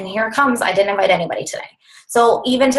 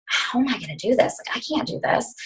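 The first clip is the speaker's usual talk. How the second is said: hushed despair; 4 semitones higher, 7 dB quieter than usual. Retold or imitated. Despairing inner thought quoted.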